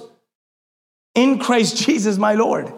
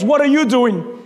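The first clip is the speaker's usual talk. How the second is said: ordinary talk, nothing marked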